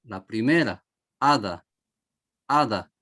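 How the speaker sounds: Ada with the first A stressed. Shown as a demonstration. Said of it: "Ahí es donde elevamos la voz".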